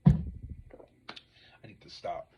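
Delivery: slow, breathy whisper to herself; quiet self-reproach after cursing; whispered self-scold. Real, not acted. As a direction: hush yourself down, slowly. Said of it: "My mom be saying I cuss like a sailor".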